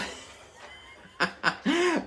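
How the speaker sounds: loud high burst of laughter. Real, not acted. Laughing after singing.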